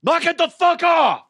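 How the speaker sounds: high, loud yell at the driver; angry shout. Retold or imitated. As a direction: roar it through the back window. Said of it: "and I go".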